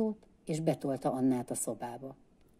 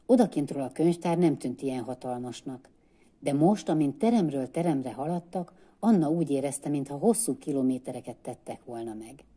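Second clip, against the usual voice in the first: plain reading voice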